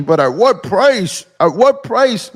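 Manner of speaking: high, drawn-out mock outcry; mock protest. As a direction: imitate a whining objector, stretching the words. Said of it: "like Adam Sosnick saying".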